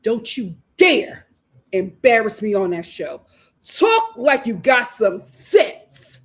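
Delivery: slow, emphatic scolding, word by word; deliberate mom warning, punching key words; stern mother. Pretend. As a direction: chew out your son over the phone. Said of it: "I would say".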